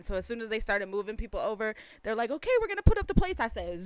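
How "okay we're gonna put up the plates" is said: high, loud and fast announcement; staff call-out. Retold or imitated. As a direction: rattle it off over a crowd. Voicing staff calling to the crowd.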